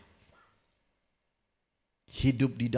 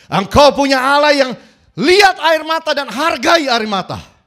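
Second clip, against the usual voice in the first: high, loud proclamation; fervent preacher declaring God sees tears; preaching at full force; 13 semitones higher, 13 dB louder than usual. Acted. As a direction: proclaim it over the congregation.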